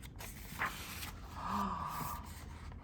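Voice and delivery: long, soft breathy gasp; awed gasp. Acted. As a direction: draw in a slow, hushed gasp. Wonder at what he saw.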